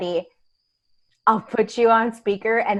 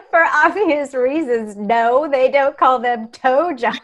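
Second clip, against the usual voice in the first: laughing; 4 semitones higher, 5 dB louder than usual. Real, not acted. Laughing at the double meaning.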